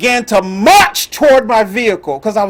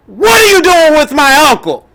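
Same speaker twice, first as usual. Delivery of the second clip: a mock holler, higher and louder; hollering niece; 6 semitones higher, 4 dB louder than usual. Niece hollering at the officer over her uncle, mock holler. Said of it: "She hollers at the officer".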